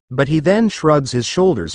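ordinary delivery

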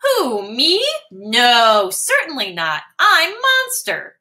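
high squeaky little mouse. Acted. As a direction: squeak it out pretending to be big. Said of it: "squeaks Mouse".

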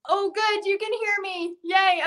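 high happy relief; light up with relief that it works; relieved excitement. Real, not acted. Relief that viewers can hear.

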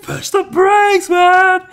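high, drawn-out mock scream; panicked yelling. Grandfather screams at the kid to brake, high frantic yell. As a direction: holler each word slowly at full pitch.

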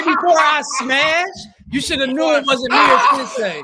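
high, shouted objection; animated shouting over the reaction; loud protest. Real, not acted. Player loudly protests the wrong guess.

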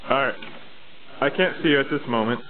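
ordinary delivery

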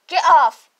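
ordinary talk

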